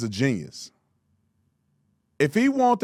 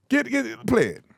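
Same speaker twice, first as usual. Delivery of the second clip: ordinary talk, nothing marked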